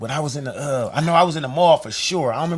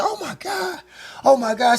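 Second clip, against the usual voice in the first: excited squeal; 9 semitones higher than usual. Retold or imitated. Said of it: "she said".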